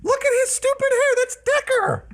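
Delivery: high, pleading call to stop; urgent calling out. Acted. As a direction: call out, trying to stop him.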